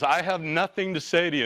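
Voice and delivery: high, dismissive retold brush-off; mock curt refusal. Owner refuses to talk.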